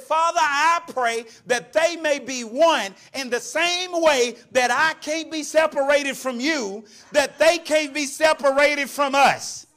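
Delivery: high, slow, ringing prayer; preached prayer of Jesus. Acted. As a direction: proclaim the prayer slowly to the church. Acts out Jesus praying, preaching.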